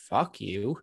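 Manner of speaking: ordinary talk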